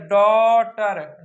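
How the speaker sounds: "डॉटर" very slow with a long rounded aw; drawn-out aw. Shown as a demonstration. Teacher reads the word for pupils, slow "डॉटर" with long aw. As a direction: stretch the first vowel of "डॉटर" as aw. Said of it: "डॉटर नहीं, डॉटर".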